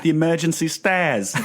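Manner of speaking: a panicked Cockney in rhyming slang, overdone; mock Cockney. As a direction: ham up a Cockney urgent call. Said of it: "What is that?".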